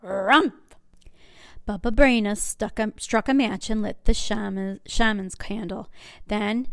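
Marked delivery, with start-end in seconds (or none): 0.0-0.7 bear grunt. A narrator's reading.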